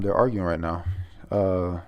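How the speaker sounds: ordinary delivery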